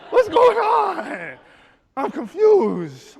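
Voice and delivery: very high, loud, drawn-out wail; hammed-up squealing panic; bewildered. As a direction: cry it out in utter confusion. Teller bewildered by situation.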